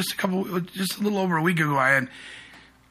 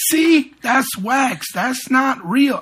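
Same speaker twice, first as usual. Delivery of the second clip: higher, slow, emphatic demonstration; play-acted insistence, pitched up and drawn out; mock cocksure husband; 9 semitones higher, 3 dB louder, much slower than usual. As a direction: imitate a man proving his point, slowly. Retold husband proves it is wax.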